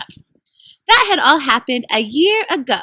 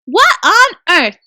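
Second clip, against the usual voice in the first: startled; 5 semitones higher, 8 dB louder, slower than usual. Acted. Peter startled by magic pull.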